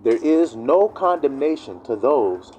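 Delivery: "There is no condemnation" slow and deliberate; good and clear. Shown as a demonstration. Preacher making sure people hear, slow "no condemnation". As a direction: weigh each word of "no condemnation". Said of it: "I gotta say it good and clear".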